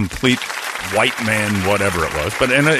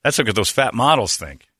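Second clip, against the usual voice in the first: usual speaking voice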